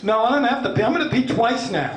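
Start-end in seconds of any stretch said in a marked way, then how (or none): none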